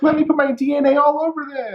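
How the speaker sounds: high, sing-song mock eagerness.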